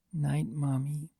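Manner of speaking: soft, drowsy half-whisper; sleepy whisper. Acted. Sleepy colt whispering goodnight to his aunt, soft. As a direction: drift off mid-word. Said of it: "he whispered".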